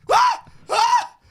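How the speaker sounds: high shrieking mock scream. Friend imitating his terrified screams, shrieking. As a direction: shriek like a scared kid. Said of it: "I could imagine you being like".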